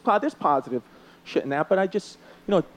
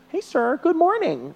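higher, sing-song overly sweet hello; mock polite greeting; 9 semitones higher, slower than usual. New neighbours greeting the comedian, lightly mocked sweet politeness. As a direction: play the too-friendly new neighbour lightly. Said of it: "they come in, you know".

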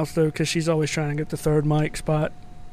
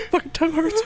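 mock whimper; 12 semitones higher than usual. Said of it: "she was like".

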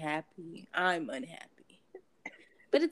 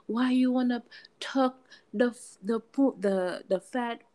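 put-on loose Jamaican accent, a touch higher and louder; 5 semitones higher, 5 dB louder than usual. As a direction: tiruan aksen Jamaika yang agak dibuat-buat. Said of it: "my whatever kind of accent I just gave y'all".

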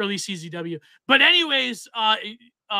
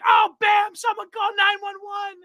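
much higher shouted announcer call; heel announcer; 12 semitones higher, 3 dB louder than usual. Retold or imitated. Retelling John House's call as a shouted heel announcer. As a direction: yell it like a gleeful heel commentator. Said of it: "he would go like".

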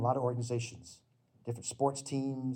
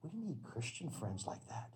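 sincere aside; 2 semitones higher, 13 dB quieter, slower than usual. Acted. Quiet point after story.

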